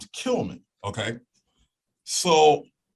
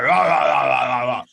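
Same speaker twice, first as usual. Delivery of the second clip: taunting; 6 dB louder than usual. Retold or imitated. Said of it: "started taunting them".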